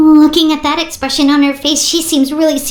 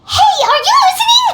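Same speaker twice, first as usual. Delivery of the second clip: calling out; 12 semitones higher than usual. Acted. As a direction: snap the Traveler back to attention. Paimon calls distracted Traveler.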